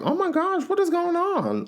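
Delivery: hammed-up high shocked voice; shocked Lauren. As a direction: play a prim onlooker gasping at chaos. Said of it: "Lauren is sitting back like".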